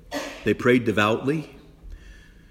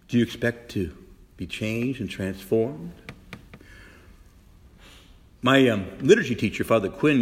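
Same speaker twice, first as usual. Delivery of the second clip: normal talking voice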